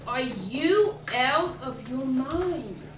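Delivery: slow, loud retold tirade, rising on the questions; appalled mother's yell, retold for laughs; mother screaming in outrage. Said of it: "she starts screaming".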